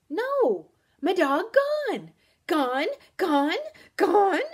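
high, loud insistent shrieking; creature's shrill, panicked shriek. A narrator's reading. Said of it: "Guest's voice rose to a shriek".

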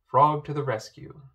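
usual speaking voice

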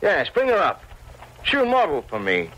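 high, exalted declaration; fervently excited. Acted. Painter to Torp about his new model, fervently excited. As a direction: declare it with soaring pitch, carried away. Painter seized by masterpiece idea.

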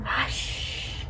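soft whispered hush; whispering. Acted. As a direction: breathe it out like a secret. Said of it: "a quiet old lady who is whispering".

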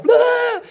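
sudden high blurting "blah"; mock overload blurt. Speaker mimicking phone overload to congregation, high blurt. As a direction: blurt it out like your brain just fried.

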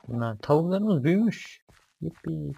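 high and loud, delighted; excited. Real, not acted. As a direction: cheer it out with joy. Delighted the chickens grew.

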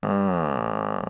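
deep pondering hum; low, thoughtful witch murmur. Acted. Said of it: "murmura-t-elle en réfléchissant".

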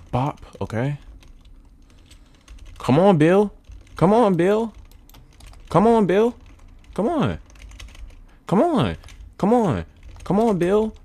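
high-pitched, repeated urging; tense gamer coaxing; urgent pleading. Real, not acted. Urging game character on.

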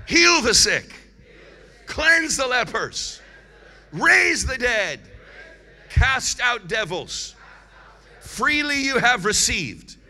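loud, drawn-out call for the congregation to repeat; preaching call-out. Real, not acted. Preacher leads congregation in reciting scripture, loud preaching call-out. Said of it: "Let's say it together".

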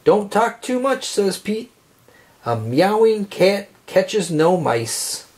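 usual speaking voice

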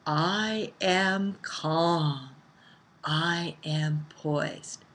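quiet power, unhurried; calm affirmation. Shown as a demonstration. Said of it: "you come from that calm place inside".

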